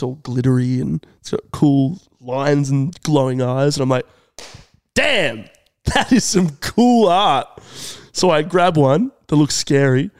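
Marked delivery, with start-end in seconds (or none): 4.9-7.5 drawn-out, higher-pitched awe; impressed. Retold or imitated. Shopper spotting a scary wolf container, impressed. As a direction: savour the admiration slowly.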